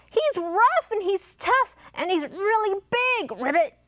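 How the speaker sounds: high-pitched frog warning with a ribbit; dramatic high frog voice; storybook frog. A narrator's reading. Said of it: "said Frog".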